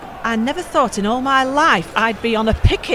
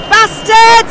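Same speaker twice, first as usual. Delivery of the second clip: furious yell; 11 semitones higher, 10 dB louder than usual. Acted. After seeing miner bleed.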